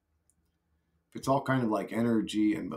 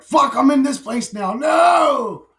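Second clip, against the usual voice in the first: high, loud yell of horror; anguished yell; 12 semitones higher, 9 dB louder, slower than usual. Retold or imitated. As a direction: scream as if trapped. Soul trapped in body.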